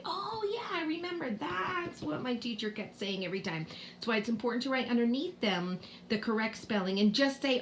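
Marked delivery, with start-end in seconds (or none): 0.0-3.7 put-on high-pitched child's realization; pupil remembering. Pupil recalling what the teacher said, imitated in a high voice. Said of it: "They'll say".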